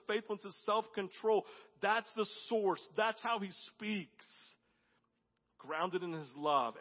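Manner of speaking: loud, high and slowing, every word hammered; preaching at full force. Real, not acted. Pastor preaching to the congregation, building to a roar. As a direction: build the sermon to a roaring peak.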